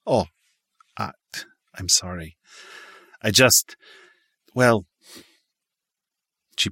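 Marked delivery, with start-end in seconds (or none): 0.0-4.8 halting, embarrassed stammer. Acted. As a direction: stumble over the words, flustered. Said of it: "He immediately blushed and stammered".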